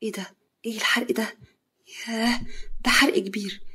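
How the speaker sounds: hushed, soft shock. A narrator's reading. Yara seeing his big burn, hushed shock. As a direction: gasp it softly in dismay. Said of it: "يارا بصدمه".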